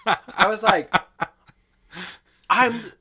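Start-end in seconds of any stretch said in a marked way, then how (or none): 2.5-2.9 high, loud retold apology; loud apologetic outburst. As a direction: gush out a mock-panicked apology. Retells an apologetic drive-thru exchange.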